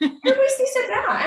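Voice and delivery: high, laughing repeat; laughing rebuke. Retold or imitated. Repeating her self-scolding, high and laughing. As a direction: laugh it out at yourself. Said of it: "And I'm like".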